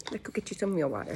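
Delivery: quiet, fast aside to herself; quick mutter. Real, not acted. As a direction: mumble it quickly under breath. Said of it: "Let's go get you some real water, okay?".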